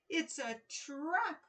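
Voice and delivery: a hammed-up little wail; mock wail. Worried king to his daughter, mild slow mock wail. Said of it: "wailed King Knish".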